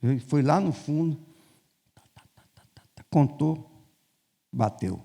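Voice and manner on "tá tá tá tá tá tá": whispered rapid ta-ta-ta counting; muttered counting noise. Retold or imitated. Diretor counting the stock at the back, whispered ta-ta-ta. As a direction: count under your breath in quick syllables.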